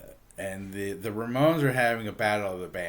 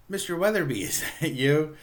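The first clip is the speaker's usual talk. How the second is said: higher, louder mock astonishment; retold surprise; 6 semitones higher, 4 dB louder than usual. [name] to the principal in the audience, mock surprised. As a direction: act out startled recognition, lightly.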